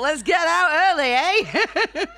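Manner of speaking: high, loud, laughing cheerful suggestion; mock-breezy idea, laughing; chirpy plan. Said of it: "I thought".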